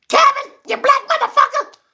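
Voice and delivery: high screeching mock old aunt; aunt yelling. Mean aunt yelling at young Kevin, high mock screech. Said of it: "Used to call me".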